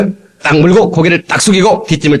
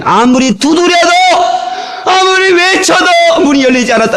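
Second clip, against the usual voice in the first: rising pleading cry; 13 semitones higher than usual. Real, not acted. Preaching climax, rising.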